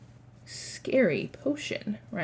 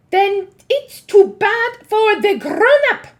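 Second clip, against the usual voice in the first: high, loud, slow witch voice; Grand High Witch; 11 semitones higher, 11 dB louder, slower than usual. Acted. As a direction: sneer it like a cruel witch queen. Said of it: "said the Grand High Witch".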